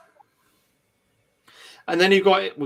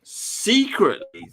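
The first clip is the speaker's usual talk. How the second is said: "secretly" again, slow and pitched higher, leaning on it; higher secretly repeated; 7 semitones higher, much slower than usual. Acted. Host to listeners, repeating "secretly" higher and slower. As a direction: lift "secretly" up and stretch it the second time.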